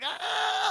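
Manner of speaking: high, loud mock scream; retold girl's scream; pained scream. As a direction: shriek as if your ears hurt. Girl hurt by feedback.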